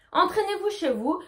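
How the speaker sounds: normal talking voice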